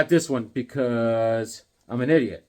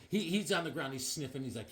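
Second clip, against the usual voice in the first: normal talking voice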